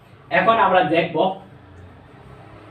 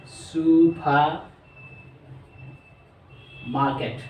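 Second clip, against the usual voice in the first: very slow supermarket, each syllable held; much slower than usual. Shown as a demonstration. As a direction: spell out supermarket in sound, slowly. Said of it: "চারবার উচ্চারণ করা হয়". (Supermarket খুব ধীরে টেনে)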